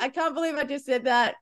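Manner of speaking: high, amused self-mockery; retold astonishment, pitched high and giggly; laughing disbelief. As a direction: marvel at your blunder through laughter. Retelling her amazed reaction, laughing.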